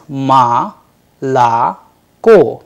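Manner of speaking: "ma la ko" split into separate syllables. Shown as a demonstration. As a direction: break молоко into ma, la, ko. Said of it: "is pronounced as".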